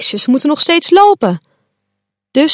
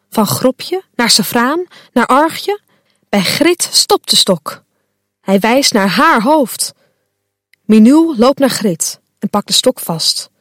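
plain reading voice

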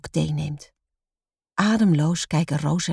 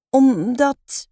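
plain reading voice

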